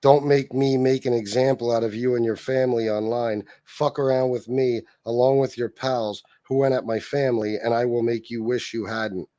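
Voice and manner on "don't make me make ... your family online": usual speaking voice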